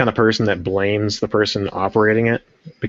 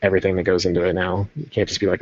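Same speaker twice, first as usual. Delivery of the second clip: ordinary delivery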